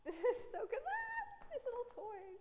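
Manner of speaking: laughing. Real, not acted. Delighted by the drawing.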